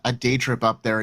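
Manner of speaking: usual speaking voice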